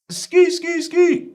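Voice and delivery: high-pitched skrrt-style ad-lib; high ad-lib yelp. Pretend.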